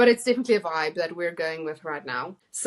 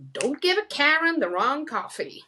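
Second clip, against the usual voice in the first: higher, a sassy warning; sassy attitude; 6 semitones higher than usual. Shown as a demonstration. Reading a friend's quote with a sassy Karen attitude. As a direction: say it like a fed-up customer. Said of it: "I had to say it with that attitude".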